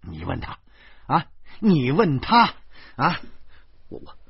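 stammering rage rising to high shouting; furious. Acted. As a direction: rant with indignant, stuttering outrage. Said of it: "我怒不可遏地指着豆豆说".

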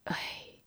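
a faint breathy sigh of regret; soft sigh. A narrator's reading. Old Taoist sighing over the boy. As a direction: let out a quiet old man's sigh. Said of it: "童阳叹了口气".

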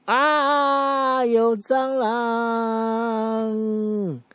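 very high, stretched-out mock shriek; child screams at a giant cockroach, very high and drawn out; terrified child scream. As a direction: scream like a small kid in terror. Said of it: "那个小朋友就说".

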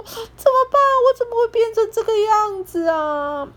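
high, frantic question; panicked. Acted. Piglet staring at his monster face, high panicked wail. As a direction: squeal it out in alarm. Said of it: "想说".

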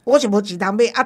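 normal talking voice

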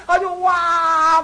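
slow drawn-out mock wail; imitating a small boy's crying wail; retold wail. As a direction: stretch the wa into a childish cry. Little boy wails after being seen.

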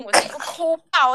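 usual speaking voice

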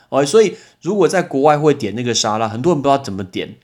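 ordinary talk, nothing marked

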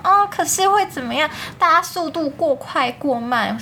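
hammed-up fretting schoolmate; mimicking fussy junior. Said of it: "他就还什么什么".

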